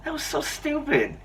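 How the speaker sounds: high squeaky, mock-outraged; indignant high complaint; incredulous scoff. Retells reaction to his cousin.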